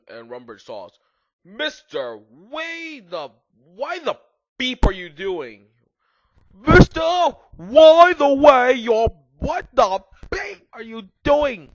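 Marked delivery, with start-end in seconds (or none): 1.4-5.7 high, indignant scolding; angry shout. A narrator's reading. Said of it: "Rumbridge shouted angrily". 6.5-11.7 very high, full-force shriek; angry shout. A narrator's reading. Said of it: "Rumbridge shouted angrily".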